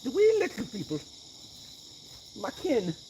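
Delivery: high, playful Irish lilt on "wee"; a leprechaun claiming his kin; lightly hammed Irish brogue; mock Irish. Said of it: "My Irish was coming out".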